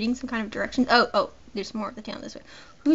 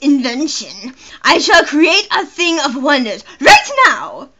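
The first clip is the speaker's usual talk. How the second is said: loud, high, theatrical villain voice with big laughs; hammed-up inventor, triumphant and booming; mad inventor character; 4 semitones higher, 12 dB louder than usual. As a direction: declaim it like a gloating mad scientist.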